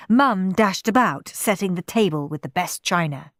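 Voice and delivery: usual speaking voice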